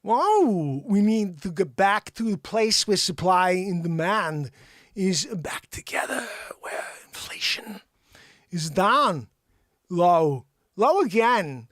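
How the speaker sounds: slow Walken drawl with odd pauses; Christopher Walken impression. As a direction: do a halting Walken, breaking words oddly. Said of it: "what if Christopher Walken came along".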